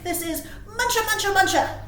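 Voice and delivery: usual speaking voice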